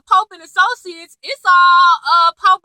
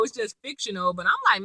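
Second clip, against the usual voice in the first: usual speaking voice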